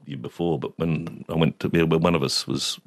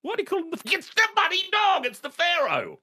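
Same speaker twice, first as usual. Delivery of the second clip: very high-pitched mock yell; retold yelling. Irate father ordering son to grab dog, yelling. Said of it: "he's yelling at you".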